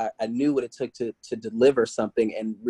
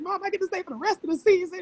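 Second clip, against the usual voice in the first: much higher, fast and bubbling; excited squeal; faster than usual. Retold or imitated. Telling her mom she gets to stay, excited squeal. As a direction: gush the news up high.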